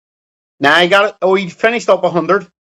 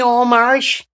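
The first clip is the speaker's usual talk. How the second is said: high, whiny mock of a friend; sneering impression of a mate claiming to be Irish; mocking Chico; 8 semitones higher than usual. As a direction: parrot him in a silly high voice. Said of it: "Chico, you're always telling me".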